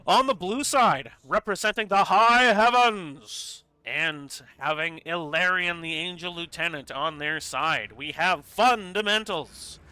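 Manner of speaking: big, slow arena announcer voice; hyped esports caster introducing the teams; announcer intro. Acted. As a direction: boom out the team introduction.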